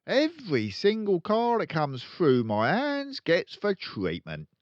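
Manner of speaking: loud, high, brash dealer father; boastful crooked dad. Acted. Father bragging to his kids, loud and brash. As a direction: brag like a swaggering car salesman.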